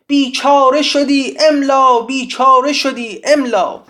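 high, loud defiant chant; almost shouting. A narrator's reading. Siavash lost in his poem, almost shouting it out. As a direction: cry out the verse at near-shout pitch. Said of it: "تقریباً فریاد کشید".

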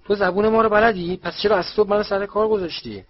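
high, loud indignant outburst; angry. A narrator's reading. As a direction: demand an explanation furiously. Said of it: "فاج با عصبانیت گفت".

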